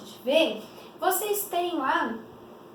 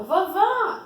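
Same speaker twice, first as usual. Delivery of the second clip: amazed call; 6 semitones higher, 4 dB louder than usual. Acted. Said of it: "reproduzindo em discurso direto".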